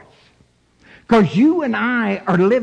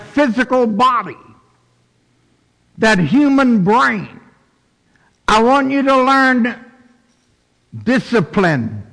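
raised, emphatic preacher's voice; preaching; 5 semitones higher than usual. Acted. Preacher voicing God's love to the congregation, emphatic preaching. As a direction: preach it higher, with deliberate pauses.

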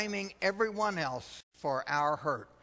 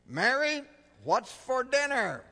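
louder call; 7 semitones higher, 5 dB louder than usual. Acted.

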